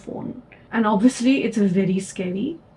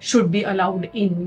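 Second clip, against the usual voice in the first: ordinary talk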